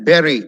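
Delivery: bury as firm "BERRY"; correct pronunciation. Shown as a demonstration. Teacher likening it to berries, correct pronunciation. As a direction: rhyme bury with cherry. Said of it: "അതുപോലെ വേണം പറയാൻ".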